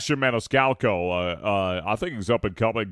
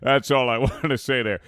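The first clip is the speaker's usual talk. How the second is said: usual speaking voice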